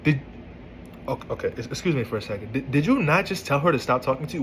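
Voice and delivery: ordinary talk, nothing marked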